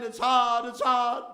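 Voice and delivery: hammed-up loud moaning; mock whine. Speaker mocking complainers with a loud moan. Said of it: "I hear people saying".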